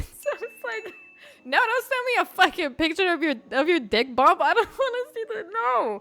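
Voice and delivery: high, laughing no way; laughing refusal. Retold or imitated. Refusing his friend's picture, high laughing protest. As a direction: squeal it out through laughter.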